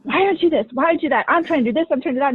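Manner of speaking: mock-heated venting at her husband; exasperated outburst. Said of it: "why are you raising your voice".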